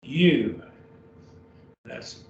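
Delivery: ordinary delivery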